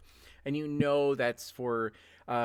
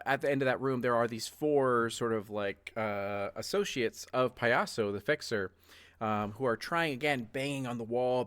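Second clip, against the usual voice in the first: ordinary delivery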